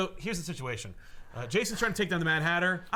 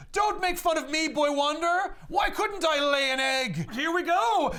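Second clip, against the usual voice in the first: high-pitched ranting yell; Mad Hatter yelling; 12 semitones higher, 4 dB louder than usual. Retold or imitated. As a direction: shout it like an unhinged cartoon villain. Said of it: "he's just yelling".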